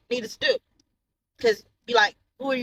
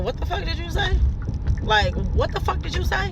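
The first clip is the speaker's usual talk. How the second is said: higher, confronting demand; angry confrontation; 8 semitones higher than usual. Retold or imitated. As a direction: get in her face and demand it. Confronting daughter, retold quote.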